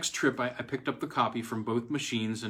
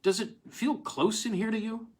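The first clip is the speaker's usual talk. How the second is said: higher, tired voice asking; weary colleague; 11 semitones higher than usual. Acted. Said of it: "he said".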